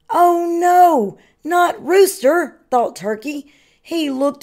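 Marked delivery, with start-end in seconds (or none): 0.1-2.6 loud, high cry of dismay; alarmed. A narrator's reading. Turkey hears they may eat Rooster, high loud alarm.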